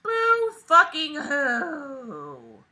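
slow, high, drawn-out fake boo-hoo; mock crying. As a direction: whine it out like a sarcastic crybaby. Mocking the story's complaint.

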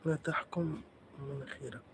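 slow hushed whisper. Real, not acted. Muttering a private aside during the stream, whispered. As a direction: whisper it slowly, barely voiced.

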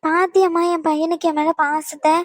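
usual speaking voice

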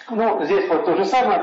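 ordinary talk

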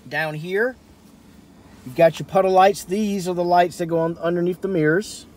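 ordinary talk, nothing marked

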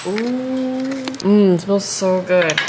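slow, relishing sniff; savoring a smell. Real, not acted. Smelling the pate.

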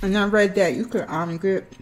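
speaking with food in mouth; slightly muffled chewing speech; chewing while talking. Real, not acted. Seen 0: food put in mouth just before; jaw chewing, hand at mouth.

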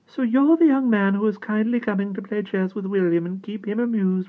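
high, loud gracious duchess; a great lady chatting to the boy; high grand-lady voice. A narrator's reading. The Duchess addresses Simon.

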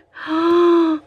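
sharp high gasp of dismay; shocked gasp. Real, not acted. Vlogger gasping at ruined washi, shocked gasp. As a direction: gasp as the sticker tears. Washi sticker goes wrong.

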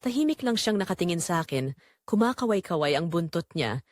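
ordinary delivery

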